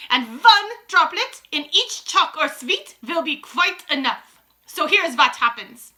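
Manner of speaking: slow, high, loud witch pronouncement; Grand High Witch. A narrator's reading. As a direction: relish each word as the ruling witch.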